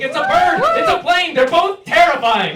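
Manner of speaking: high, loud panicked shouting; terrified shout. Pretend. Anxious failed Superman spots something flying, shouting high and loud in terror. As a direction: scream it like the sky is falling. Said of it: "Superman didn't work out and his only superpower was crippling anxiety".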